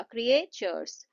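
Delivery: Creatures slowed, with weight on क्रिए; stress on first part. Shown as a demonstration. Teacher demonstrating word stress, creatures stressed on क्रिए. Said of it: "क्रिए पे लगेगा".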